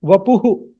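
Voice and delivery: Vapuhu in three syllables, ending hu; South Indian visarga. Shown as a demonstration. Teacher repeats South Indian form, Vapuhu with echoed hu. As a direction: echo the vowel after the h in Vapuhu. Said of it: "they will pronounce it as Vapuhu".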